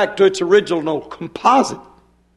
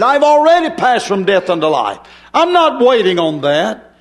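raised, insistent preacher's voice; full-force preaching; 3 semitones higher than usual. Real, not acted. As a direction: proclaim it with rising fervor.

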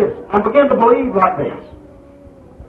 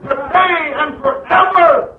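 slow, shouted, high preaching climax; preaching at full force; 4 semitones higher, slower than usual. Acted. Preacher to church, thundering the Word. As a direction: hammer each word out to the congregation.

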